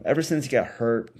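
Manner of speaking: ordinary delivery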